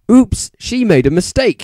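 loud, high cutting correction; correcting. A narrator's reading. Dumbledore correcting Rumbridge, loud and high. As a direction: cut her off with a correction. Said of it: "he corrupted her".

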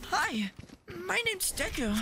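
very high anime character voice; Deku. Acted. Introducing the character to friends on request, high anime voice. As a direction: pitch it way up into cartoonish anime. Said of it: "Do the voice again".

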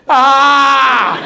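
high, loud squawk; phone shriek. Retold or imitated. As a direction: screech out the garbled phone noise. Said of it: "it was in per".

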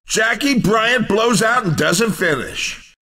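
ordinary delivery

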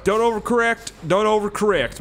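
high, tense repeated command; urgent warning. Real, not acted. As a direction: call it out as the truck swerves. Urgent self-command while driving.

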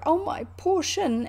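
high, drawn-out wail; slow, self-pitying lament, pitched high; pained cry. A narrator's reading. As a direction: wail it as if hurt. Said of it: "he cried".

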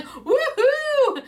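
high, loud audience whoop; cheering woohoo. Retold or imitated. Fan retelling her cheer in the live audience, high whoop. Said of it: "You can hear me going".

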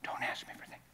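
hissed warning under the breath; mom's whisper. Retold or imitated. Mother scolding her child in church, whispered. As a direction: whisper it sharply so nobody hears.

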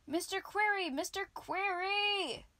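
high, loud repeated calls; calling out. A narrator's reading. Wizard searching for the glowworm, calling loudly. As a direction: keep shouting the name, drawing it out. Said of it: "Ombric shouted".

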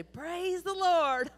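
high, slow, drawn-out praise; joyful old lady. Retold or imitated. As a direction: call it out from the porch, stretched and ringing. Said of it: "she would stand at the front porch and say".

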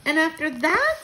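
high, loud question rising on THAT; quivering with excitement. A narrator's reading. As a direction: ask "And after that?" eagerly, pitch climbing at the end. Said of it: "I said, quivering with excitement".

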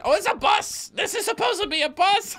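high-pitched incredulous outburst; amused disbelief. Real, not acted. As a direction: blurt it out high in delighted disbelief. Realizing the prop is a bus.

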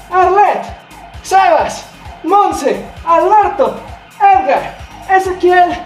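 shouted countdown, high and punchy; rally the whole class by name; barking gym coach. Acted. Coach counting names and reps. (entrenador gritando la cuenta atrás)

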